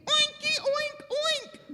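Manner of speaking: very high piggy oinking; chorus of pigs. Acted. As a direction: oink high like happy little pigs. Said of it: "they're all saying".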